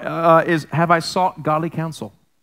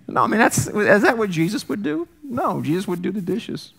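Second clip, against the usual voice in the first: normal talking voice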